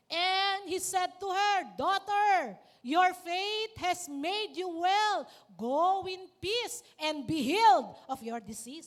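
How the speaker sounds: slow, loud proclamation of the verse; scripture reading. Acted. Preacher reading Jesus's words aloud, slow and solemn. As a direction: proclaim it to the congregation.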